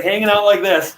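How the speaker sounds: ordinary talk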